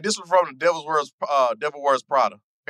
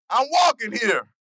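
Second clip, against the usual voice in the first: slow, higher New York street shout; New York yell; 7 semitones higher, slower than usual. Retold or imitated. Host quizzing friends, a slow New York yell. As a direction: bark it like a New York cabbie dodger. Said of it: "quoting Midnight Cowboy".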